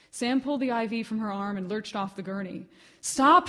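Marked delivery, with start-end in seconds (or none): 3.0-3.5 high, loud desperate cry. Acted. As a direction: yell it to stop her in panic. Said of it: "she cried".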